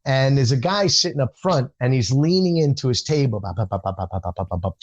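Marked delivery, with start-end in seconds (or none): 3.4-4.8 low, quiet run of bop-bop babble; soft, rapid bop sounds as background chatter; imitated chatter. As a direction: mimic someone yapping away under the performer.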